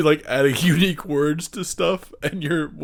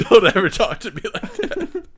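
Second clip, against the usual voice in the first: higher-pitched objection through laughter; laughing protest; 6 semitones higher than usual. Real, not acted. Friend telling friend off over a nickname, laughing. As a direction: crack up while telling him off.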